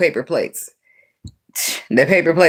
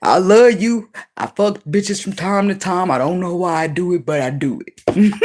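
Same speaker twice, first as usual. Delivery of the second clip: mock sheepish Jody confession; 4 semitones higher than usual. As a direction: ham up a clueless cheating boyfriend. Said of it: "one of them Jody ass dudes".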